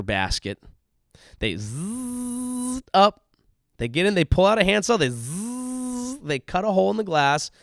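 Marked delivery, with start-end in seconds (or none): 1.4-3.2 mock machine whirr. Imitated cherry picker rising. 5.0-6.2 mock sawing noise. Imitated handsaw cutting glass.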